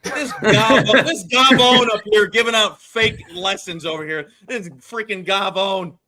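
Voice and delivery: loud mock scolding; Italian-American scolding. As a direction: play an Italian uncle scolding.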